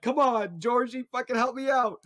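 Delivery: high whiny plea for help; high panicked pleading. Retold or imitated. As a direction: beg the guard in a high voice. Steve pleading with guard.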